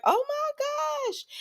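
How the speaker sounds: high, slow, shrieking oh my gosh; gasped oh my gosh. Retold or imitated. Tracy seeing Danny walk up, shrieking in surprise. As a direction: stretch it out in a squealing shock. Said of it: "and she's like".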